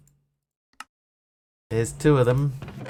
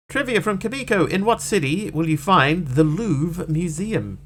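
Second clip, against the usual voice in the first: quiz host; 6 semitones higher, 3 dB louder than usual. Pretend. Reads trivia question aloud.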